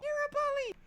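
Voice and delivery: loud, very high put-on squeal; shrill mocking hater voice; mimicked hater. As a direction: squeal it as a mock accuser. Mimicking his online haters.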